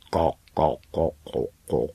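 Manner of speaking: slow hen-cluck voice; staccato clucks into a drawn-out taunt. A narrator's reading. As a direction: cluck it out like gossiping hens. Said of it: "Slepice to poznali a volali".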